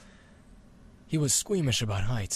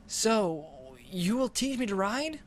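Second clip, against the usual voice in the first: put-on higher, hopeful boy asking; small boy pleading, higher; eager boy; 9 semitones higher than usual. Boy asking the dragon for lessons, higher and eager.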